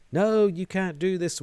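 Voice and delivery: high, loud, slow yell. Acted. Woman being dragged away yelling at the cultists. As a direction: cry out in desperate protest. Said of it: "a woman yells".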